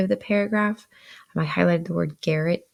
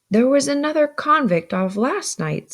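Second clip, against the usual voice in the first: higher-pitched character voice; Joe speaking aloud; 9 semitones higher, 5 dB louder than usual. A narrator's reading. Joe explains to Pip, speaking aloud. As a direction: lift the voice out of the whispers. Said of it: "said Joe aloud".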